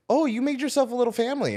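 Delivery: high quick mom voice; playful high-pitched mother impression; imitating mom. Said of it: "she was like".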